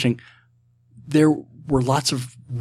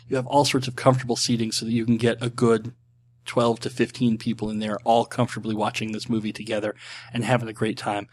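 usual speaking voice

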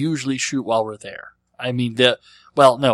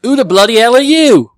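loud, high mock British accent; hammed-up British accent, shouted high; 11 semitones higher, 14 dB louder than usual. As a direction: bark it out like a cartoon Brit. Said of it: "You could have thrown in a bloody hell there".

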